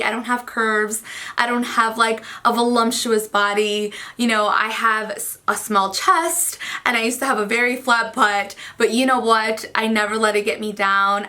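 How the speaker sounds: usual speaking voice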